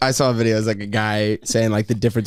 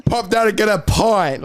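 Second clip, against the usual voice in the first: put-on higher, slower slangy British accent; brash British; 8 semitones higher than usual. What England is really like, brash pub-talk British.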